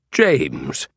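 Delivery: low, forceful British gentleman; voiced Sherlock Holmes. Holmes placing James's name, in a commanding voice. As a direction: pronounce the name low, as recognition lands.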